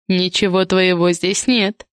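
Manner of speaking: ordinary delivery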